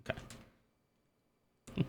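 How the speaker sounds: breathless whispered okay. Real, not acted. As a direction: whisper it under your breath. Whispered aside.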